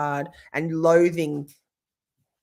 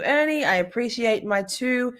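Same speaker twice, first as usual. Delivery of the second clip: normal talking voice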